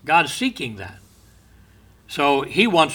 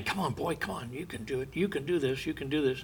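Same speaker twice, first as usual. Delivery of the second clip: hushed urging; 11 dB quieter, faster than usual. Retold or imitated. Father urging his crying baby through it, hushed encouragement. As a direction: softly urge the boy on, much quieter.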